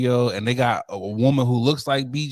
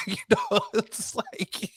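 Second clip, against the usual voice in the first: laughing; 9 semitones higher, 4 dB quieter, slower than usual. Real, not acted. Laughing at his own joke.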